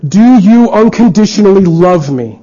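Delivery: pleading question. Acted.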